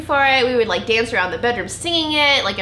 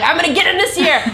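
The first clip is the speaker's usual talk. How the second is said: higher, mock-determined little girl; eager schoolgirl vow; 8 semitones higher, 5 dB louder than usual. Hopeful girl checking the class roster, eager and higher. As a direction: act out a hopeful kid's vow. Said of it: "I'd be like".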